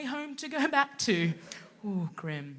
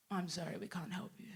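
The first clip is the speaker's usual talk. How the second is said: mumbled brush-off; 5 semitones lower, 20 dB quieter than usual. Retold or imitated. Boss dismissing.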